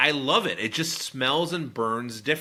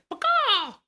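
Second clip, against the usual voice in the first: much higher, squeaky mock of "Pecan."; mocking bird voice; 3 dB louder than usual. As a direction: chirp "Pecan." like a bird to tease him. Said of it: "You sound like a bird".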